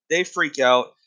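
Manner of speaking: ordinary talk, nothing marked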